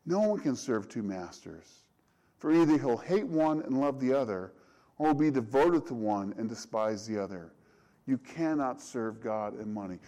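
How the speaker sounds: solemn Bible reading; scripture reading. Acted. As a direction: read the verse like scripture. Reads the scripture verse aloud.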